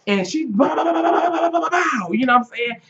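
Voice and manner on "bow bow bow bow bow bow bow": high, rapid mock gunfire; gunshot sounds. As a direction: rattle off the shots. Imitating gunfire.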